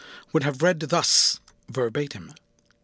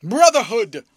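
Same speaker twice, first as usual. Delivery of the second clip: a fierce, much louder, higher bark of one word; ferocious voice; 7 semitones higher, 11 dB louder than usual. A narrator's reading. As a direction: hurl the word at him. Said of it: "in a ferocious voice".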